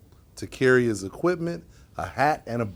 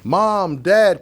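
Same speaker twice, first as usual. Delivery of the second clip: higher, louder call to parents; a raised, eager storybook cry; 7 semitones higher, 6 dB louder than usual. A narrator's reading. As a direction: call out as a child spotting their parents. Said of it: "cries Alex".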